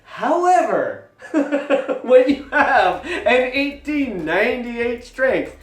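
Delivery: real laughter breaking through the words; laughing. Real, not acted. Vlogger to viewers, laughing at his blunder. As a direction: chuckle while pushing the line out.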